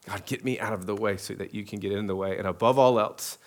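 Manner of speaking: soft, hushed pastoral prayer. Acted. Pastor praying over congregation, hushed prayer voice. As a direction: pray it low and intimate to God.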